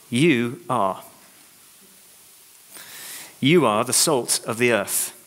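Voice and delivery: slow, weighty "You are"; stress on "You are". Shown as a demonstration. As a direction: lean hard on "You are". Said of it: "the first two words ... I want you to remember".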